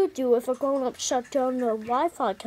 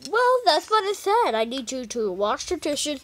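ordinary delivery

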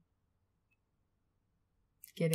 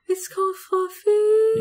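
much higher, louder and slow in delight; amazed disbelief; 13 semitones higher, 11 dB louder, slower than usual. Real, not acted. Co-host learning the dog is named Fluffy, delighted surprise.

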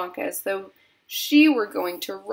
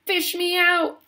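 high, urgent yell; raised, high pleading shout; yelled plea; 11 semitones higher, 4 dB louder than usual. A narrator's reading. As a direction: yell to be rescued. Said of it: "he yelled".

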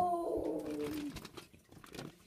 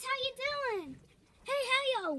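ordinary talk

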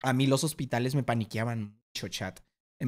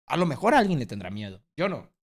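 usual speaking voice

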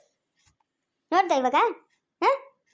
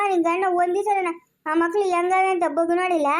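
usual speaking voice

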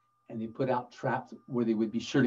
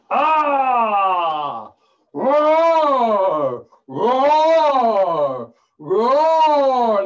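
loud, high, long-drawn roars; roaring lion; 7 semitones higher, 12 dB louder, much slower than usual. Acted. Storyteller plays the lion caught in a trap, roaring loudly. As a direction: roar like a trapped, angry lion.

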